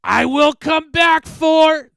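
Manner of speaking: slow, high, deafening monster roar; drawn-out demonic scream, cut short; specter's shout. A narrator's reading. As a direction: bellow a last threat as you're dragged away. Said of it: "we heard it shout".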